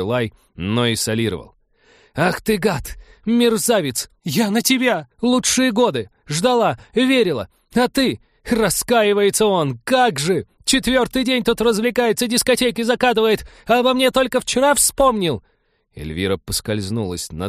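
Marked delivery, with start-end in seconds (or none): 2.1-8.2 high shrieking rage; furious screaming. Acted. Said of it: "Разъярённая Эльвира". 8.5-15.4 high shrieking rage; furious screaming. Acted. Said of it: "разъярилась ещё больше".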